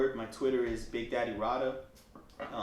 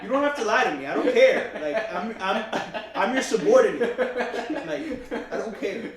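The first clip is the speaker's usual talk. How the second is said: laughing retort; 10 semitones higher, 9 dB louder than usual. Retold or imitated. Retelling, laughing while recounting own retort.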